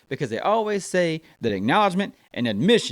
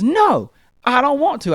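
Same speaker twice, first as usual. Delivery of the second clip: put-on whiny child refusal; 7 semitones higher, 4 dB louder, slower than usual. Daughter refuses third time.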